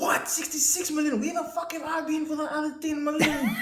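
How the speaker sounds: high shocked disbelief; surprised. Real, not acted. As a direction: gasp it out in disbelief. Shocked at population figure.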